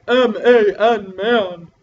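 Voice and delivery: a hint of a gruff man. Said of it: "talking in a man voice and being like".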